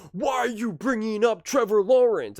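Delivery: high, loud mock yell; Nick yelling. Host mimicking Nick yelling at a colleague, mock yell. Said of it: "He yells at Wild".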